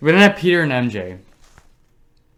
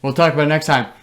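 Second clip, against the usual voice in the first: higher, firm cut-off; insistent brush-off; 4 semitones higher, 4 dB louder, faster than usual. Real, not acted. Host cutting off the cohost's guess, insistent brush-off. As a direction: talk over them to close the subject.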